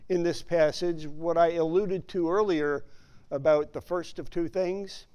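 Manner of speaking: usual speaking voice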